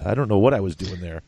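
ordinary talk, nothing marked

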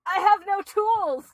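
high, strained and trapped; panicked whimper. Real, not acted. Trapped by spider.

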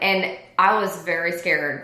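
ordinary talk, nothing marked

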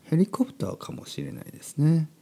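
ordinary talk

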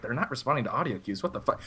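imitating an operator's quiet disbelief; soft, retold bewildered aside; baffled operator. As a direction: drop it to a puzzled undertone. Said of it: "the woman was kinda like".

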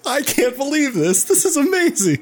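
high-pitched gloating delight; gleeful delight. Real, not acted. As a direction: squeal with delight at his bad luck. Gleeful at friend's punishment.

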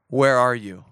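low, searching call of God. Acted. God calls to the hiding man, low and searching.

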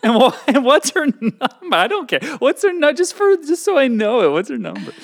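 high, loud laughing demand; cracking up while pressing; laughing eagerly. Real, not acted.

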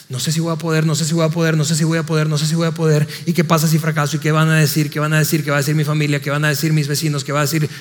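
low, monotone run of worried thoughts, acted; anxious inner loop. A doubter fretting over failure and what family will say, anxious. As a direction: rattle off mock worries in a low, unbroken loop. Acts out fearful, repeating thoughts.

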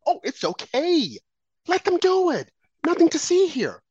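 high, slow, dismissive sing-song; sarcastic parody of a shrug-off; mocking impression. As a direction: wave it away in a high sneer. Mocking the left's excuses.

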